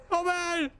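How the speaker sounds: desperate shout. Real, not acted. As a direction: scream it open in panic. Door won't open in chase.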